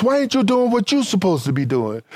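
ordinary talk

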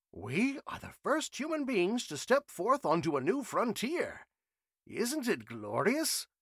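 put-on high, thrilled old scholar; eager professor voice. A narrator's reading. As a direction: proclaim it like a great discovery. Trenchley voiced, grand announcement.

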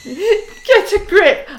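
high, slow, laughing get a grip; teasing husband over his tearful mood, laughing; mock scolding. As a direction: tease him loud through laughter. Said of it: "sent him a little message saying".

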